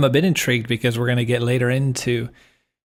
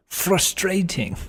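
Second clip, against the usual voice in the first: slow, drawn-out British "Frustrating"; mock British accent, dragging out "Frustrating"; imitating British roommate; 8 semitones higher, much slower than usual. Teasing his co-host by imitating her old British roommate's accent. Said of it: "my old British roommate in New York".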